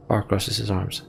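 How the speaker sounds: ordinary delivery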